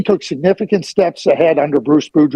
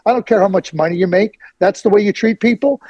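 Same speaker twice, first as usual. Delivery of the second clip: ordinary talk, nothing marked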